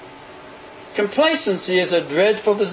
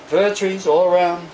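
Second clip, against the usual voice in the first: usual speaking voice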